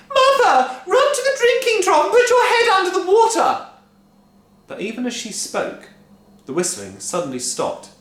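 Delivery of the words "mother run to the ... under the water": high, loud shriek. A narrator's reading. Wife shrieking to her mother, loud and high. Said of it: "shrieked Missus Cranky".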